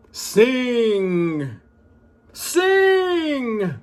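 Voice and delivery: booming high order, rising into a full yell; giant's commanding shout. Acted. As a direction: bark the command at the harp like a greedy giant. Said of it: "and said to the harp".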